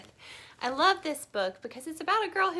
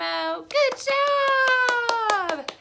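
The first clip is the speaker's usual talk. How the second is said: high, loud, drawn-out cheer; excited praise; 13 semitones higher, 8 dB louder, much slower than usual. Real, not acted. Praising the children.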